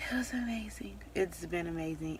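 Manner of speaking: hushed, breathy aside; whisper. Real, not acted. Mom whispering to viewers how amazing pregnancy has been. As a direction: whisper it, moved.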